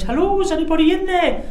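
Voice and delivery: high, loud put-on voice calling into a tent; a hammed-up stranger calling out; mock intruder call. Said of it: "seen the tent knocked and went".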